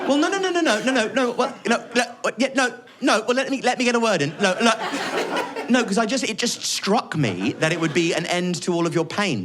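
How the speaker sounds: a mum backpedalling in a panic; flustered mum. Retold or imitated. Mum to her son, flustered and backtracking.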